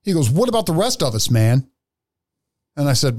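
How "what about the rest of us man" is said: plain reading voice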